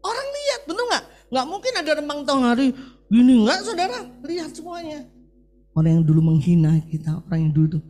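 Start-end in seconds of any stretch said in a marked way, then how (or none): 0.0-5.1 high, ringing pulpit cry; full-force preaching. Acted. Pastor proclaiming God's promise to the congregation, soaring preaching.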